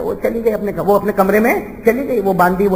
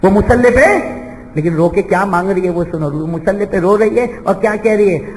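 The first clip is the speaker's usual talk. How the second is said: ordinary talk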